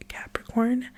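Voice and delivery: ordinary talk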